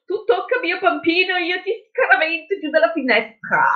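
high-pitched protective mum impression; mock threatening mother. Friend imagines the mother confronting the kidnappers, mock threatening.

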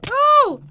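high, loud echoing "oh"; shocked yell. Real, not acted. Player seeing opponent fall, shocked yell. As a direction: yelp in shock.

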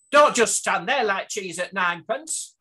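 a hint of a bossy Lancashire granny; Lancashire mill woman. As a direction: snap it like a mill worker telling off a slacker. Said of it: "the mills in Lancashire".

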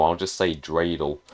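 ordinary talk, nothing marked

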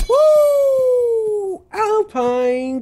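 high whoop cracking into a drawn-out cry; slow, high, cracking cheer. Real, not acted. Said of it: "Never mind the voice crack".

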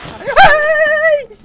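long piercing shriek; startled scream. Real, not acted. Player turning back hits a scare, startled scream. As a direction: scream high and very loud.

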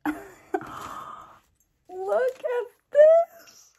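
high, loud, half-laughing shriek; excited shriek. Real, not acted. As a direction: shriek with joy at the gift. Opening a gift package.

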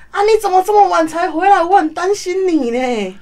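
imitating the boss fussing over her; concerned boss. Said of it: "然后老板就出来".

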